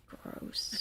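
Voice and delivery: whispered gross; disgusted. Real, not acted. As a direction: breathe it out in disgust.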